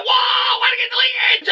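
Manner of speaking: very high panicked shout; mock panic. Boss demanding answers in a high panicked squeal. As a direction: squeal it in exaggerated alarm.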